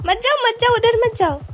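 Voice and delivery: high-pitched urgent warning. Real, not acted. Warns teammate away from danger. (घबराकर रोकना)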